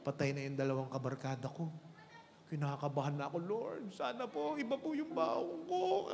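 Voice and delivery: trembling with dread, begging God; nervous prayer. Acted. Third worker's nervous prayer.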